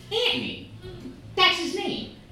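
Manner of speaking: high mock Queens accent; Queens lady. Queens mom correcting the name, Queens accent. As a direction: insist on "Anthony" the Queens way. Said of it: "this lady from Queens".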